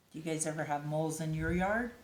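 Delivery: usual speaking voice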